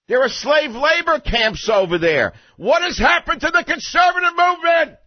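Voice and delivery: high-pitched indignation building to a shout; escalating, outraged high-pitched tirade; mounting outrage. Real, not acted. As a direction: start worried, climb into a loud, high, incredulous rant. Mounting outrage over inviting Kim.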